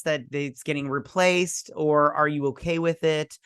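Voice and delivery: normal talking voice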